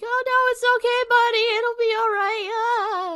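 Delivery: high, loud, flustered reassurance; panicked comforting. Retold or imitated. Worker meeting a crying kid at work, panicked comforting. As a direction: act out frantically soothing a crying kid. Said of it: "I panic a little bit".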